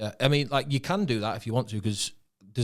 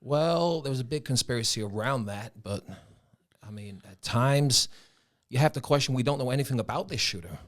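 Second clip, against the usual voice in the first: put-on general American accent, higher pitched; 4 semitones higher than usual. Asked to talk on a topic, in an American accent. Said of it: "I can do American".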